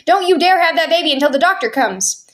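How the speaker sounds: high, urgent frightened scolding; frightened shouting. A narrator's reading. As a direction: order her in a mild panic. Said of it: "she kept shouting to Mother".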